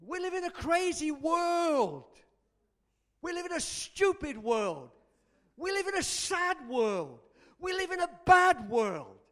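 high, drawn-out declaiming to a crowd; full-force preaching. Real, not acted. Preacher lamenting the world to his congregation, full-force proclamation. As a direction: hammer out each sentence, pausing between.

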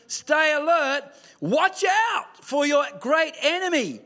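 ordinary talk

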